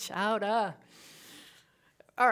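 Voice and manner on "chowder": Boston chowder, hammed up; Boston accent. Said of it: "the accent just comes through".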